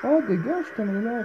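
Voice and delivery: sudden loud yelp; startled yell. Real, not acted. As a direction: shout her away in a fright. Monster appears, gamer yells.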